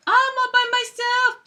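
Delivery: very high, mock-sad cartoon bear; high-pitched pretend loneliness; high lonely bear. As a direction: squeak it out forlornly.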